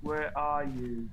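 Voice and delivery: very slow, soft eerie call; slow and quiet. Acted. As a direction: draw it out softly. Calling out in haunted house.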